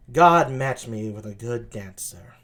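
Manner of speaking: seductive, unhurried low tones; sultry voice. Acted. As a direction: play her low, languid and sultry. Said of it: "sultry voice like that".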